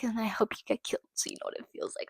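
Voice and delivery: voiceless hissed-out repeat; whispered. Real, not acted. Wishing the killer harm, repeated in a voiceless whisper. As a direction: drop to a bare whisper on the repeat.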